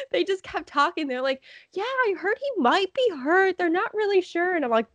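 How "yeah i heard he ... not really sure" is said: high, put-on gossiping voice; imitated old fan. Old fans speculating about injury, imitated. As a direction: mimic an uncertain old-timer, pitched way up. Mimics older men chatting on.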